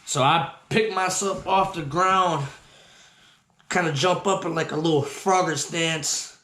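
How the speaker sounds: normal talking voice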